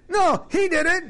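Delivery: put-on high shouted child's protest; squabbling kid. Preacher mimics the other child shouting back. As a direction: yell it back like an indignant little kid. Kids blaming each other in a fight.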